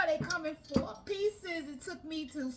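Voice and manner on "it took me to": ordinary talk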